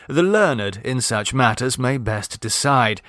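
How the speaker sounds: usual speaking voice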